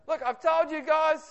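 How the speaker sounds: stressed teacher voice climbing high and breaking; voice going up. Retold or imitated. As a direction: pitch shooting up under stress, slow and strained. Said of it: "my voice goes up a little bit".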